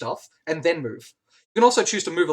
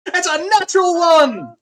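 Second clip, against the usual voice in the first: high, loud announcer's cry; excited shout; 11 semitones higher, 8 dB louder than usual. Real, not acted. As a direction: stretch the last word out in glee. Game master announces a critical roll.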